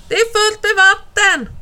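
high-pitched alarmed report; calling out. A narrator's reading. Alf tells his parents of the flood, calling out. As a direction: call out the emergency to parents. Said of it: "ropar Alf igen".

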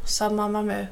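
ordinary delivery

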